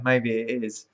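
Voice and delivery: ordinary talk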